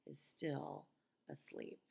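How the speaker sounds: hush, the family's still sleeping; soft storyteller's whisper. A narrator's reading. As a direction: whisper it, hardly voiced. Tiptoeing, everyone asleep.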